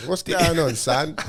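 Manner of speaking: playful imitation of a Cockney dad. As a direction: greet him like a real Cockney father. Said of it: "my dad is a real Cockney".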